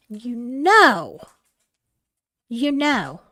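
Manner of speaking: normal talking voice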